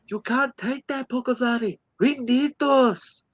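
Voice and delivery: put-on high-pitched child with accidental Scottish accent; Scottish-sounding kid. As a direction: plead like a little kid, vaguely Scottish. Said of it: "apparently they're Scottish too".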